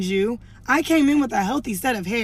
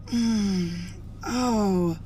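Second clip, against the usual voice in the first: a pointed, disapproving little hum and oh; mock hairdresser fishing for attention with a sniffy hum; a light imitation of a passive aggressive murmur; passive aggressive noises. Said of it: "she starts making noises, like, super passive aggressive".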